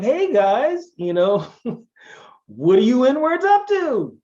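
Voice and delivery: high, breezy mock buddy voice with a laugh; hammed-up fake-friendly hello; mock casual group greeting. Outsider sliding into the group, mock casual greeting. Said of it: "you don't get to just slide in the group like".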